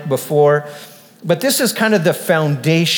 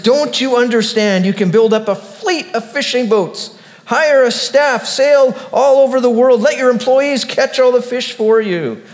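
raised, heated pitch; angry businessman; 6 semitones higher than usual. A narrator's reading. Businessman scolding the fisherman, angry and raised. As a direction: businessman losing patience, arguing hard. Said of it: "he got angry by now".

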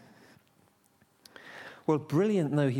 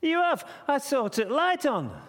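shouted insistence; 14 semitones higher than usual. Acted. Joseph insisting to the innkeeper, shouting in protest. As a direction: protest at the top of your range.

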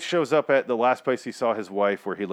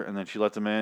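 ordinary talk, nothing marked

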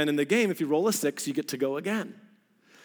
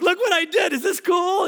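high, loud bragging call; shouted high boast; excited shout; 11 semitones higher, 5 dB louder than usual. Retold or imitated. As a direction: show off to the whole room. Calling friends to brag.

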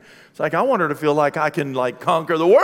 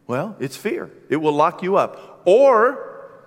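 ordinary talk, nothing marked

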